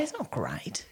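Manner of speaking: whispered aside, voice dropped to nothing. Real, not acted. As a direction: lean in and whisper it like a secret. Confiding criticism of husband.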